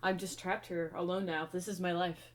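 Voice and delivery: low, downcast muttering; mock resigned cat. Cat alone after owner leaves, mock-gloomy resignation. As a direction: lightly play a pet giving up hope. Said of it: "he's just like".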